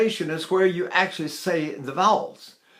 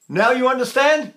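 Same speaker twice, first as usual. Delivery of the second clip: higher, louder proud question; 7 semitones higher, 5 dB louder than usual. Retold or imitated. [name] asking the masterclass, imitated. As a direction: ask it like the great tenor. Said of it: "he said".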